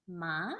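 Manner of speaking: "Ma" gliding upward from low; second tone. Shown as a demonstration. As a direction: lift "Ma" like a question. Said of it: "like a question".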